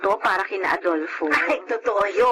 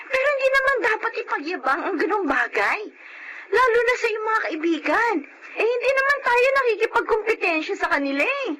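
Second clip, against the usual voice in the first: exasperated outburst; 13 semitones higher than usual. Acted. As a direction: burst out, fed up with the bragging. Wife protests neighbor's bragging.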